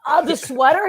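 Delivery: high, loud sheepish question; retold flustered excuse. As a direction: offer a panicked excuse, replaying it.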